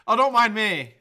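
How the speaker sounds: loud sarcastic "Oh, don't mind me" with "dont" punched; loud mock apology. Shown as a demonstration. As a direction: call out "don't mind me" to the viewers. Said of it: "It's a live show".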